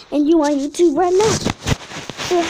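loud, high excited reveal. Real, not acted. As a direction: shout it out with glee. Surprise reveal to friend.

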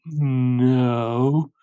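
drawn-out, hummed start to "no"; coy denial. Acted. Olivia answering her worried parents, coy and drawn-out. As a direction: stretch the word out slyly.

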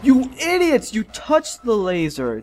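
high, loud outburst easing down; exasperated scolding. Real, not acted. Player scolding characters who touched the laser, exasperated shout. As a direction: yell at bungling teammates.

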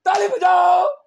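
high, loud call to a crowd; goading shout. Real, not acted. Speaker scolding a crowd for not clapping, high goading shout. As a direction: shout at them to get them moving.

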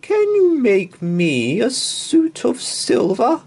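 high, loud, slow regal request; queen asking the tailor, high and stately. Acted. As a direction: ask it grandly like a queen. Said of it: "said the Queen".

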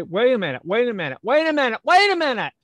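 frantic repeats climbing sharply in pitch and volume; mounting panic. Real, not acted. Presenter stops the host while fumbling with screen share, increasingly panicked. Flustered presenter halts the helper.

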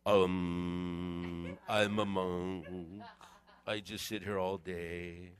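low, slow, dull monk drone; mock a lifeless monk sitting all day; a droning, bored monk, low and slow.

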